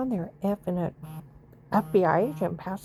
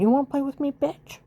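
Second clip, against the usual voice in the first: taunting challenge; 6 semitones higher than usual. Real, not acted. Challenges the people harassing her.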